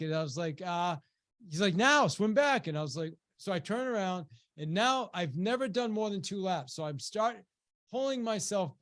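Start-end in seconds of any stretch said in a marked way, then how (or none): 1.8-2.7 raised, louder mock coach command; insistent coach order. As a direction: push the swimmer on, higher and louder. Coach insists over swimmer's protest.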